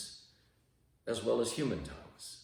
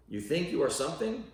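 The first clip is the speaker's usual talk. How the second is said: higher, louder scoffing question; mocking challenge; 9 semitones higher, 4 dB louder than usual. Preacher mocking the self-important listener, higher scoffing rise. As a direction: needle them for thinking they're special.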